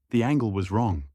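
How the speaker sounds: ordinary delivery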